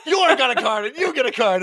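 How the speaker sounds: high voice bubbling with laughter; mock excited dealing. Acted card dealing, laughing.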